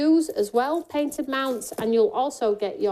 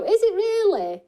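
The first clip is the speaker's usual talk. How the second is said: high, loud and delighted; a bright squeal of surprise; surprised; 10 semitones higher, 4 dB louder than usual. Real, not acted. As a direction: pretend amazement at the news. Excited surprise at news.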